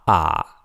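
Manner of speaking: AH held low and level; low flat tone. Shown as a demonstration. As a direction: keep AH low and even. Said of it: "are the four tones".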